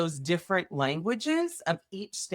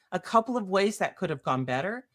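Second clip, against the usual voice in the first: ordinary talk, nothing marked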